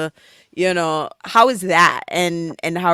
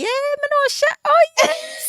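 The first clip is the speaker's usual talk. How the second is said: high, put-on patois brag; squeaky high patois bragging voice; mock Jamaican patois boast; slower than usual. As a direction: flex like a proud islander showing off a connection. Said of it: "me can flex, say".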